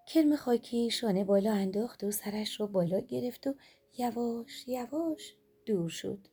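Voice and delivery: ordinary delivery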